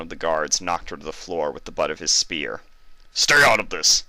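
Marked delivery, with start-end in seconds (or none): 3.1-4.0 louder, harsh barked command; barked order. A narrator's reading. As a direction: bark him down. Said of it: "he barked".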